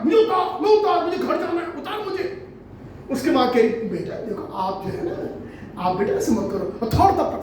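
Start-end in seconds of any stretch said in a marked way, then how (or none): 0.0-2.5 put-on high, insistent child's wail; bratty child yelling. Retold child yelling on train. 3.7-6.9 soft, low, slow coaxing; soothing mother. Retold or imitated.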